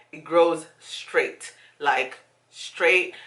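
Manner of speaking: straight drawn out slowly with a rise; slow emphasis. Real, not acted.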